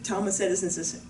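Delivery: ordinary delivery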